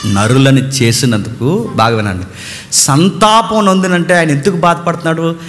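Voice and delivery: normal talking voice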